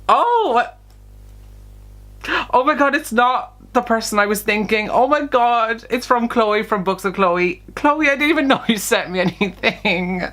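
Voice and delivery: high-pitched, overwhelmed surprise; real astonishment. Real, not acted. Vlogger discovering which friend sent the gift, astonished. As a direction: let the pitch jump up in happy disbelief. Finds unexpected sender of gift.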